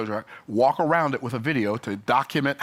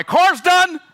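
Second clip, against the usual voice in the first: exasperated disbelief; 9 dB louder than usual. Real, not acted. Fed up with dealer phone loop.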